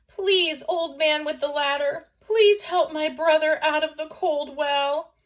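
high, loud, desperate plea; frightened pleading. A narrator's reading. Chang begs the old man to save his brother, pleading. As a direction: beg urgently at high pitch.

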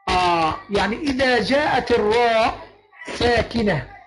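usual speaking voice

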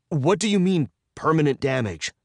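alarmed demand. A narrator's reading. Captive hearing his friends may be harmed, alarmed demand. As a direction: demand an answer, pitch jumping up.

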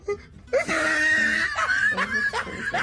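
high squeal of shock. Real, not acted. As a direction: squeal it in disbelief. Reacting to her new teeth.

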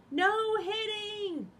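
high, slow, loud call after him; cried out. Acted. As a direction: shout it after someone running off. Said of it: "Piggy cried after him".

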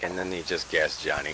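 low, soft, let-down retelling; deflated disappointment. Retold or imitated. Player mimics own reaction to the gassed Johnny clip, deflated. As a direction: imitate yourself sounding quietly deflated. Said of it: "the total disappointment in my voice".

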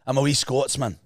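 demonstrated Scottish accent. Shown as a demonstration. Host corrects co-host, showing Scottish accent. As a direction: model a clear Scottish accent. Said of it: "but in the accent".

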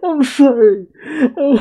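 high voice broken up by laughing; helpless laughter. Real, not acted. Viewer apologises after own sexy remark, high helpless laughter. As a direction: apologise through a real laughing fit.

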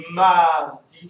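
mardi said loud, stressing the MAR sound; leaning hard on the first syllable of mardi; stressing the start of mardi. Shown as a demonstration. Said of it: "ça commence comme marron".

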